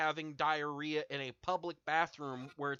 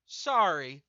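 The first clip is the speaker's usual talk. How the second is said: calm with menace; 4 semitones higher, 6 dB louder than usual. Retold or imitated. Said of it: "as calmly as I am right now with that fucking inflection".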